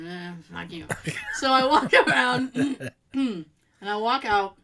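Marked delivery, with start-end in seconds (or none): 0.0-0.8 low mumbled brush-off under the breath; muttered. Retold or imitated. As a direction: mutter it quietly, dismissively. Muttered retort in retold story.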